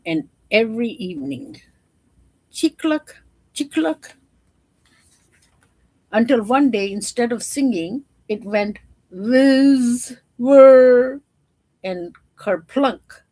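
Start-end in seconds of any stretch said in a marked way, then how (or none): 2.5-4.1 mechanical chirp. Acted. Mechanical bird singing. 9.1-13.2 breaking machine noises. Acted. Mechanical bird breaking.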